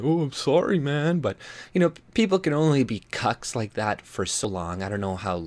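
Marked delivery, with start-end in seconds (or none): 0.0-1.2 meek apology. Retold or imitated. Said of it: "cucking and being".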